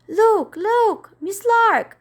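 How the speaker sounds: put-on high, loud boyish call; excited cry. Michael calls to Miss Lark, high excited cry. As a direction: shout it out, thrilled to spot him. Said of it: "he cried".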